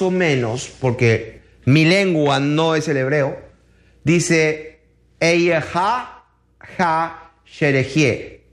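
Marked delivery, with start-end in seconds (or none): 5.2-8.4 "Eyeha ha sherehie" sounded out in rough Hebrew; "Eyeha ha sherehie" with a drawn-out "sherehie"; approximate Hebrew pronunciation. Shown as a demonstration. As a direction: pronounce "Eyeha ha sherehie" phonetically, pausing before "ha". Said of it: "esta palabra fonéticamente en el hebreo, más o menos".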